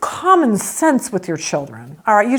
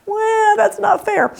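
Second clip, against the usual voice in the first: high whiny protest; whiny teen; 11 semitones higher, slower than usual. Retold or imitated. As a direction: whine like a sulky teenager. Kid's whiny protest quoted.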